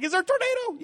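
very high, hammed-up fright; mock panic. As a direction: squeak it like a scared kid.

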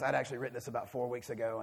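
normal talking voice

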